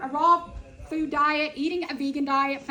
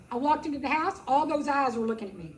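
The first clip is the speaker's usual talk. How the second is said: ordinary talk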